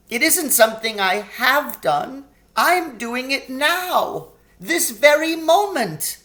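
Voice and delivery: high, slowing faun voice; a guilt-stricken faun, slowing down as he admits it; the remorseful faun tells Lucy he is betraying her now; faun's confession. A narrator's reading.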